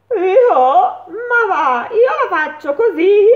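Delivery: loud, high, boisterous horse. A narrator's reading. Horse boasting to the mole, loud and high. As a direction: bray it out proudly like a horse.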